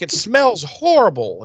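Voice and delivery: high-pitched, prissy scolding voice; scolding Wes. Retold or imitated. Wes scolds Cordelia to climb out of the vat, in a high prissy voice. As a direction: play up a fussy schoolmarm telling her off. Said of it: "scalding Cordelia".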